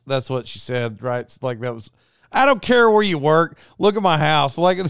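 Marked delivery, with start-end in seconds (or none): 2.3-4.6 loud exasperated rant. Real, not acted. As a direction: push it out, fed up. Exasperated retort about his house.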